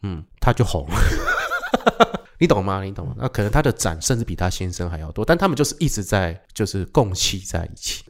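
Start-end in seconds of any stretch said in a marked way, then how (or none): 1.0-2.2 a sudden high squeal of a laugh; high burst of laughter. Real, not acted. Amused squeal laughing at the wife's fame.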